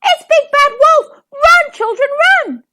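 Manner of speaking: high, loud panicked hen; shrieking mother-hen alarm; squawking hen. A narrator's reading. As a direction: squawk a frantic warning to your chicks. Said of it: "squawked Missus Chicken".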